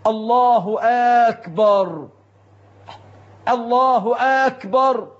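"أكبر" opened with a long questioning aa, "aakbar"; همزة استفهام على أكبر. Shown as a demonstration. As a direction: say "الله أكبر" as "allaahu aaakbar". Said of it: "بعضهم ينطقها هكذا". (مدّ همزة "أكبر" في أولها كالاستفهام)

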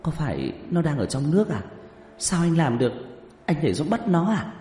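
put-on high, amazed young woman's questions; astonished questioning. A narrator's reading. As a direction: ask in wide-eyed disbelief. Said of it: "sửng sốt một hồi lâu rồi hỏi".

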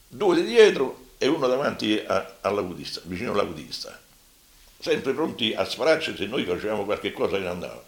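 ordinary delivery

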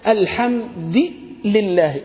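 "الحمد" ends in kasra: al-hamdi lillahi; shadh reading with kasra. Shown as a demonstration. Teacher demoing the rare kasra reading. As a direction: say "الحمد" with i on the dal, alhamdi. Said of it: "بالكسر".

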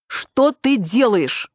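yard-keeper's shout. A narrator's reading. Nina yells at her father over the ashtray, yard-keeper's voice. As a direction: scold like a street sweeper. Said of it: "крикнул я на отца дворницким голосом".

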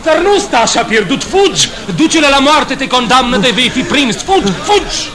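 high, loud desperate shouts; frantic urging. Acted. Benvolio urging Romeo to run from death, frantic. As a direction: push him out the door with your voice.

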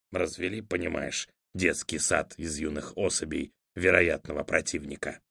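quiet grumble. A narrator's reading. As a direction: mutter it under your breath. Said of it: "добавил тихо".

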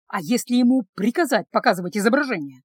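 ordinary delivery

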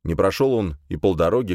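ordinary delivery

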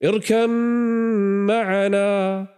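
high, very slow pleading call; father calling out. Acted. Noah calling his drowning son, drawn-out plea. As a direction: stretch it out, calling across the water.

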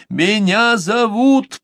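high, slow yell into the wind. A narrator's reading. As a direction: shout your name at the elements. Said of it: "проорал я в лицо стихиям". (крик на последнем дыхании, медленно)

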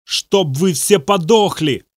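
put-on possessed boy roaring a curse; screaming. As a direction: bellow the curse. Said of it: "орал Бобби".

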